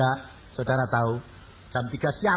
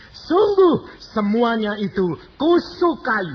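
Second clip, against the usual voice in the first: loud, slow, high proclaiming of the verse; preaching scripture; 8 semitones higher, 6 dB louder than usual. Acted. Preacher proclaiming Jeremiah to the congregation, loud and slow. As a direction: thunder the Bible verse to the church.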